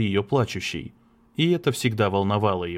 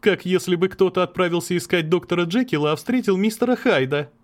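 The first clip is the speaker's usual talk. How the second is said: higher, forced light joking tone; attempted joke; 8 semitones higher than usual. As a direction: try to lighten the mood. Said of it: "пытаясь пошутить". (пытаясь пошутить)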